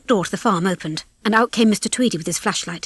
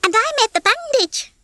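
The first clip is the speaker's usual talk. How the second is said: very high, proud hen voice; proud hen Babs. A narrator's reading. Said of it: "Babs said proudly".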